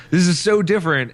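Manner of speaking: mock excitement. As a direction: sell it like a proud publisher. Mimicking Ubisoft's pride.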